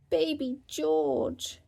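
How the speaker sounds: put-on high, slow little-girl voice; high piglet voice, very slow and proud; proud toddler. A narrator's reading. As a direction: say it proudly like a tiny sister. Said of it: "she said proudly".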